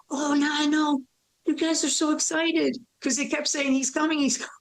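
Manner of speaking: high, excited acted voice; high-voiced delight at news; excited retelling. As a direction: pitch it up as if catching others' excitement. Retelling excitement at creator's coming.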